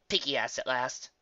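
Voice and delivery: plain reading voice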